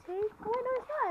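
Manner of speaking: sudden high surprised exclaim. Real, not acted. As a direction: catch yourself in surprise. Surprised to find something in pocket.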